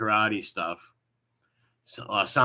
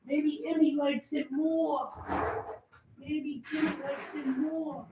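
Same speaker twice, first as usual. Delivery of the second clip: mocking whiny voice; 14 semitones higher, slower than usual. Streamer mocking his rival's self-pity, in a much higher whiny mock voice. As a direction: whine it back at him, much higher and slow.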